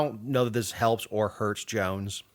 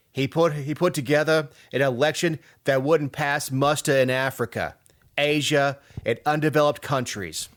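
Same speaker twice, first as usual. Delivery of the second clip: hammed-up Brooklyn accent, pitched up a little; bad Brooklyn accent; 3 semitones higher than usual. Reading out Giuliani's endorsement in a deliberately bad Brooklyn accent. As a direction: put on your worst Brooklyn voice. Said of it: "in my worst Brooklyn accent".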